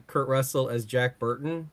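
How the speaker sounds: ordinary talk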